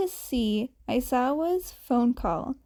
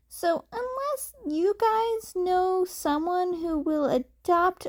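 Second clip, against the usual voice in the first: much higher, smiling; smug kid Deku; 7 semitones higher than usual. A narrator's reading. Cat kid smugly explaining the law, high and smiling. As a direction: explain it like you've already won. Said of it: "Deku said with a smile".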